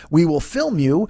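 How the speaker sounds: ordinary delivery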